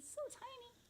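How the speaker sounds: tiny voice. Acted. Admiring the little seed.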